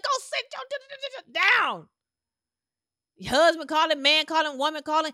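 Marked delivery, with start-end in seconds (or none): 0.0-1.9 put-on yelling at the child. Mom fed up with the baby yelling. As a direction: shriek at the kid, tripping over the word. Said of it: "you start yelling".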